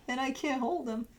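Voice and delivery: ordinary talk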